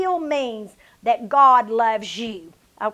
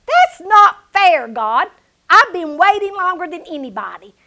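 retold indignant protest; 5 semitones higher, 6 dB louder than usual. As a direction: protest to God like a wronged child. Acting out her protest to God.

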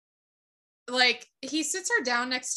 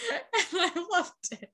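laughing; 6 semitones higher, faster than usual. Real, not acted. Laughing recap.

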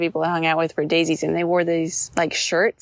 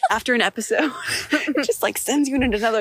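ordinary delivery

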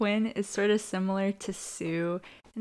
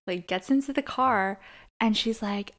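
ordinary delivery

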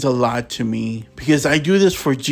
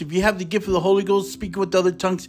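preaching fervor; 6 semitones higher than usual. Real, not acted. Preacher stirring listeners who speak in tongues, rising fervor. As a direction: voice lifts high as the preaching swells.

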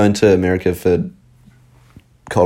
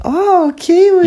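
very high, drawn-out squeal of recognition; mock gushing delight; 4 dB louder, much slower than usual. American hearing a New Zealand accent, mock high-pitched gushing. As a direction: imitate a fawning fan, stretched out and sky-high.

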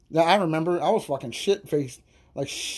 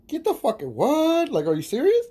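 high-pitched stunned disbelief; retold shock; 9 semitones higher than usual. Watching cousin suddenly punch a girl, high shocked disbelief. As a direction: cry out in shocked disbelief, voice jumping up. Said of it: "Like, shocked".

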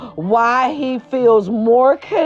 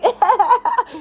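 high laugh; 12 semitones higher than usual. Real, not acted. Laughs at her own story.

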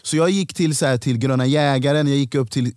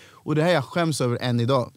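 ordinary delivery

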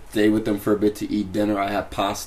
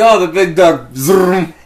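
loud, high mock excitement; excited passing driver; 8 semitones higher, 9 dB louder, slower than usual. Recounting driving past a landmark, excited and loud. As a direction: blurt it out high and loud, then zoom past.

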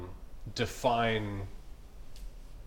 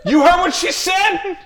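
high loud laughing exclamation; excited mock outrage; 12 semitones higher, 5 dB louder, slower than usual. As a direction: shout it with delighted disbelief. Excited rant about little girl.

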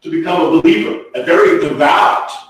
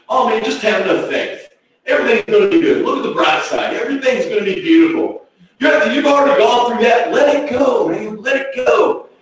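higher-pitched, hurried mock reassurance; breezy denial; 7 semitones higher, faster than usual. Preacher mimics somebody advising a person in pain, breezily dismissive. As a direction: imitate a glib friend brushing pain aside. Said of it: "is denial".

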